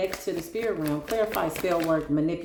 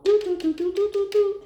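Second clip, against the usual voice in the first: high sung doo-doo dial tone, louder; imitated phone ring; 14 semitones higher, 4 dB louder than usual. Calling the Most High, she hums the ring tone high and loud. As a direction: mimic the phone ringing out, high and bright.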